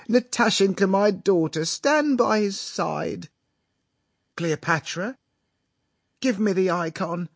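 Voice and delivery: high, theatrically tearful father; weeping father. A narrator's reading. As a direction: call the family together, hammed-up and weepy. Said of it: "the father suddenly left off weeping".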